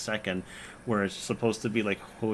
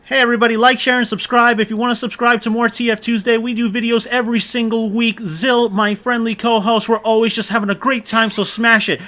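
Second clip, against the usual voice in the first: high, loud clickbait video narrator; hammy hyped channel outro; YouTuber voice; 11 semitones higher, 12 dB louder than usual. Pretend. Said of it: "Do I need to do it in a YouTuber voice".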